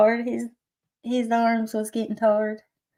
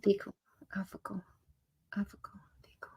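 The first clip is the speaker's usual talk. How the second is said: whispered fickle; 2 semitones lower, 15 dB quieter, faster than usual. Shown as a demonstration. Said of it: "Which one you like better?".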